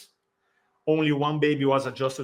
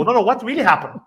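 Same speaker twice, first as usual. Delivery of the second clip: higher and louder, pushing for the truth; incredulous disbelief; 8 semitones higher, 7 dB louder, faster than usual. Retold or imitated. Midwives refuse to believe the father's story, incredulous higher louder question. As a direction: demand the real story in disbelief.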